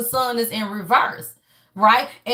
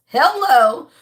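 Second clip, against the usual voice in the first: loud, pointed hello; emphatic hello; 7 dB louder than usual. Real, not acted. Reader calling out viewers mid-reading, loud and pointed. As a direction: punch it like a "you hear me?". Reader stressing the card's message.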